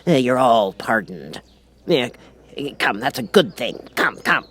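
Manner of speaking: plain reading voice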